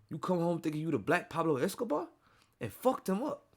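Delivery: imitated taunt rising at the end. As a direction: read the post as a mocking celebrity imitation. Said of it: "I gotta read it in the fifty voice".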